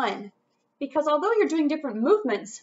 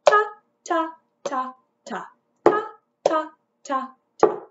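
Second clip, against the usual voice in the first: each ta spoken on the beat; rhythm syllables; 4 semitones higher, 5 dB louder than usual. Shown as a demonstration. Teacher modelling quarter notes for the class. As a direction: chant ta evenly, one per beat. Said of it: "play along with me".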